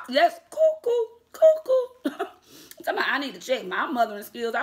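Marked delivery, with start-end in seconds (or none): 0.1-2.2 mock cuckoo. Real, not acted. Mocking commenter's behavior.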